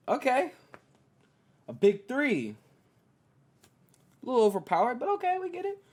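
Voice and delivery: high, loud mock agreement; exaggerated fake approval; sarcastic acceptance. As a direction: pretend to be fine with it, rolling the eyes. Mock acceptance of Harden trade.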